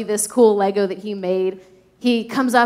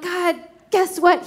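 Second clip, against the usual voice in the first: put-on eager child; 8 semitones higher, slower than usual. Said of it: "I'm like".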